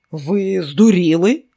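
slow, growled disbelief; growling. A narrator's reading. Flint snarling at the border guard, growling. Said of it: "прогарчав Флінт".

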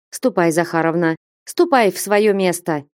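ordinary delivery, nothing marked

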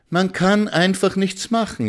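high, whiny complaint; whining. A narrator's reading. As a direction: moan it like a lament. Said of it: "jammerte Frau Schimanski".

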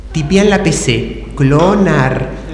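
ordinary talk, nothing marked

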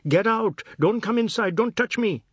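high loud terrified yell; frantic scream. A narrator's reading. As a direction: shriek to keep her away. Screaming in fear.

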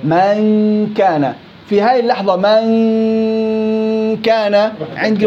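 من كان with a long nasal hum before ك; slow nasal drill; ghunna held. Shown as a demonstration. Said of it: "بدي أخفي عنده".

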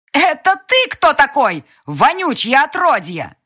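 very high, loud furious shriek. A narrator's reading. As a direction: spit the insult at him. Girl insults him furiously.

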